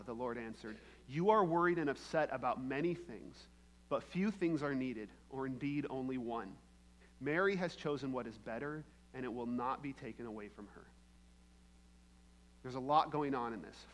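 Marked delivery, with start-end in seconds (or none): 1.1-10.9 a quiet reading of the verse; scripture reading. Acted. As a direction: read Jesus' words gently. Reads Jesus' words.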